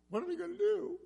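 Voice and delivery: high-pitched mock wail; crying wail. Frightened women trapped at the Red Sea, imitated crying. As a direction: wail it like a distressed woman.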